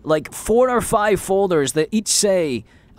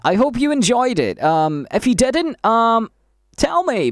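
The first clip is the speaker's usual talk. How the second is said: normal talking voice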